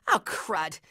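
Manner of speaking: low, soft, deflated aside; sinking dismay. Acted. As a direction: let it sink in quietly. Sinking realisation.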